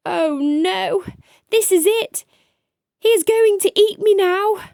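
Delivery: high, loud terrified cry; panicked thought. Acted. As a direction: panic as the monster looms. Child thinks he'll be eaten.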